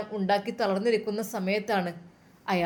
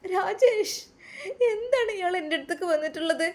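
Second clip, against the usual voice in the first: high-pitched distressed call; a woman's raised, upset cry for help; 9 semitones higher than usual. A narrator's reading. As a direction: call out to a husband in alarm. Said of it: "അവൾ ഉറക്കെ വിളിച്ചു".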